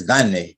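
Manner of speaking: gane slowed down, the weight on GA; stress on first syllable. Shown as a demonstration. Teacher slowly modelling gane with first-syllable stress. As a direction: lean on the GA of gane and drag it. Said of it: "the accent is actually on the first syllable".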